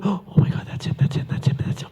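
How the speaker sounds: hushed excited whisper; breathless fangirl whisper. Retold or imitated. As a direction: whisper it like you spotted a celebrity. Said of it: "she always kept seeing me and going".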